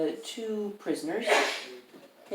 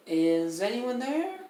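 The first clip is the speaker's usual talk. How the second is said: higher, drawn-out call rising at the end; prisoner calling out; 6 semitones higher, slower than usual. Acted. A prisoner calls out from a few of the cells, higher and slow. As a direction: call out slowly, pitch climbing.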